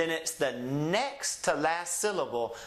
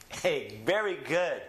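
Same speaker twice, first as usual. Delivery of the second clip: normal talking voice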